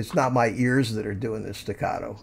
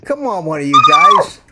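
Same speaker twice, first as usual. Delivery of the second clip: high, loud pleading call; exasperated shout; 12 semitones higher, 11 dB louder than usual. Real, not acted. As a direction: beg the chatroom out loud.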